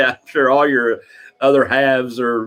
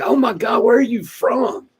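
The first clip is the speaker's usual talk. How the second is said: mock astonishment, pitched way up; astonished stranger; 13 semitones higher than usual. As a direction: imitate a high-pitched, incredulous stranger. Repeats the astonished stranger's question.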